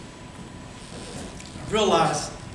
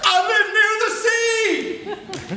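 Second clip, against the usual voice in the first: excited; 12 semitones higher, much slower than usual. Real, not acted. Sudden excited realization.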